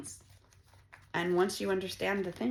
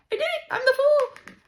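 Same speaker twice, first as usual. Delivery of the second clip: very high, loud celebration; gleeful high-pitched cheer; triumphant squeal; 9 dB louder than usual. Pretend. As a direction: crow the victory in a squeal. Celebrates being free.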